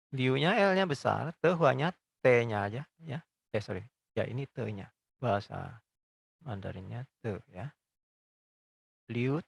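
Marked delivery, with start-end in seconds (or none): none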